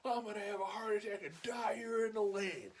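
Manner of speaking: high-pitched griping holler; mock impatient yelling. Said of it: "you hear the guy".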